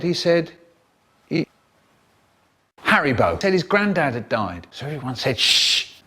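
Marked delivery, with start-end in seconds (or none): 5.4-6.0 unvoiced shushing; hushing shh. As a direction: hush everyone. Everyone hushing.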